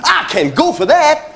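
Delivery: ordinary talk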